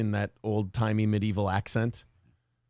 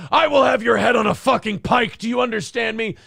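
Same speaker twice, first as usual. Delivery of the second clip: barked movie threat, high and loud; hammed-up furious threat; Mel Gibson; 9 semitones higher, 6 dB louder than usual. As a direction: yell it like an enraged action-film father. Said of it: "Mel Gibson would bark those threats".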